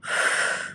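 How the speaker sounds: quiet breathy sigh to settle; exhaling to regain composure; long soft sigh. Real, not acted. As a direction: let out a slow sigh of relief. Recovering after crying.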